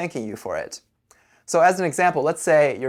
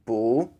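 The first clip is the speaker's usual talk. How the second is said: "Bu" with the pitch rising to the end; rising intonation; 2 semitones lower than usual. Shown as a demonstration. Teacher giving it one more time, rising tone on "Bu". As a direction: lift the tone through "Bu". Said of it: "say it with a rising intonation".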